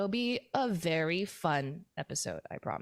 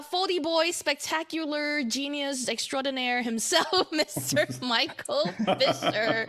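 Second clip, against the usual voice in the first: grand mock-announcer introduction, higher and drawn out; hype announcer; 6 semitones higher, 4 dB louder, slower than usual. Host introducing the podcast guest, hammed-up announcer. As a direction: build up the guest with playful pomp, stretching the name.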